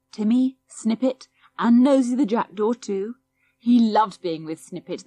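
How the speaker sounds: ordinary delivery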